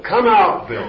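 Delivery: preacher mocking an exorcist ordering Bill out; mock exorcist shout. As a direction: bark it like a showy exorcist. Mocking exorcists questioning demons.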